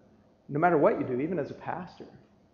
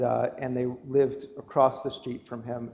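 normal talking voice

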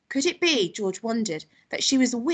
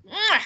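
loud, high "mwah" kiss noise; relishing lip smack; 11 semitones higher, 5 dB louder than usual. Acted. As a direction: smack it out with delight. Grandma smacks lips with relish.

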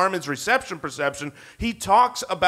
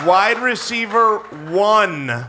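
grand announcement; much slower than usual. Acted. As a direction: announce the pick like a big reveal. Grand slow announcement of pick.

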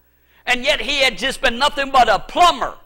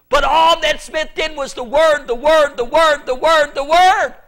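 each word louder and higher; building repetition; 3 semitones higher, 4 dB louder than usual. Real, not acted.

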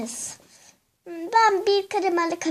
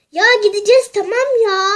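loud exasperated snap; mother snapping at the nagging child; irritated mother; 3 semitones higher, 9 dB louder than usual. Acted. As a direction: lose patience and snap back.

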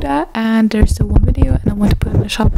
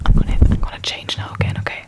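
fast whispering asmr; 3 dB quieter, much faster than usual. Acted. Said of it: "a soft spoken video again".

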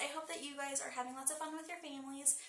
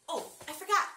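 louder, higher sudden remembering; sudden surprise; 5 semitones higher, 9 dB louder than usual. Real, not acted. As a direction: snap back, you just remembered. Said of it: "suddenly remembers".

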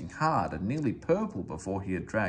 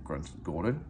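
ordinary delivery

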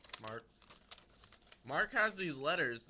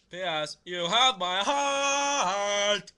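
loud, high, drawn-out mock love letter; hammed-up, half-sung letter reading; funny sing-song reading; 5 semitones higher, 13 dB louder, much slower than usual. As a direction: belt it out, stretching the words. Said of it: "I read them a funny way".

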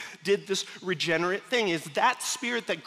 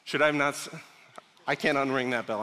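ordinary talk

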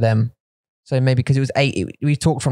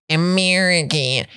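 exaggerated American accent; 2 semitones higher, slower than usual. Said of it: "That's the over-exaggeration of the American accent".

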